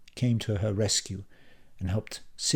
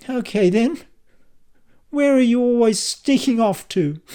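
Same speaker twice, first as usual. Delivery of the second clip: suspicious wife; 12 semitones higher, 9 dB louder than usual. A narrator's reading. Kylie confronting Dan.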